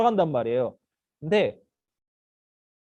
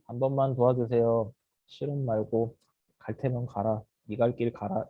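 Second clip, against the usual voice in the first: low, soft couldn't-care-less mumble; indifferent begging; 7 semitones lower, 5 dB quieter than usual. Acted. Beggar who doesn't care if they help, indifferent. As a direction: beg half-heartedly, take it or leave it. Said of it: "설마 이렇게".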